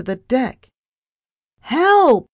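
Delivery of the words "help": very high, loud scream; screamed cry for help. A narrator's reading. Narrator about to crash into an iceberg, screaming. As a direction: scream out in alarm. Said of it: "I screamed".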